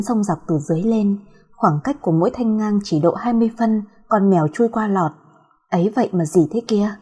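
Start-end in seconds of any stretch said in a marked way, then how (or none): none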